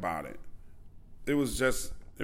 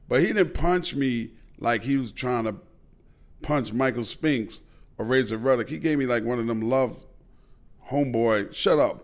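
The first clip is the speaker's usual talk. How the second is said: ordinary talk, nothing marked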